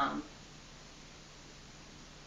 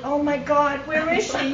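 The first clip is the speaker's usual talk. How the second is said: excited; 8 semitones higher, 14 dB louder than usual. Retold or imitated. Hearing sister is found.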